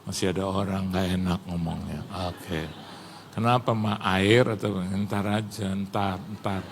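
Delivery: low, hushed aside between spouses; soft, low imitation of a wife's aside; retold private undertone. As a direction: keep it low and discreet, as if others might overhear. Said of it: "Terus dia gini".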